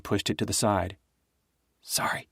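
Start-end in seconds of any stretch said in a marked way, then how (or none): 1.8-2.3 a small, teary whisper; girl apologizing to Nick after sobbing, whispered; whispered after crying. A narrator's reading. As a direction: barely breathe the apology. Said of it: "she said, wiping her eyes".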